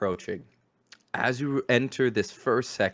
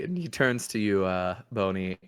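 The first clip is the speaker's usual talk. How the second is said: usual speaking voice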